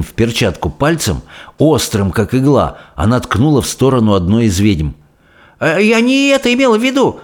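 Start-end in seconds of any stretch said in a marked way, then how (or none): 5.6-7.2 quick, high-pitched protest; frightened cry. A narrator's reading. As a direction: cry out hastily to excuse yourself. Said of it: "вскрикнула та".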